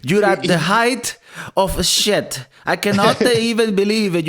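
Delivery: high, slow theatrical scorn; scornful father. Acted. Father berating his son's art, high slow mock scorn. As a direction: draw out the insult, hammed-up and pitched high.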